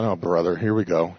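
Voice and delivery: low-pitched grumble to himself; grumbling thought. Retold or imitated. Speaker retelling his inner reaction to the trip leader, low grumble. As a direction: retell a private complaint, dropped low. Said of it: "I'm already complaining".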